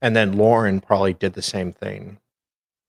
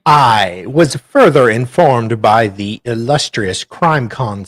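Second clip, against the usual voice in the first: formal reading; 3 semitones higher, 10 dB louder, slower than usual. Acted. Reads court document aloud.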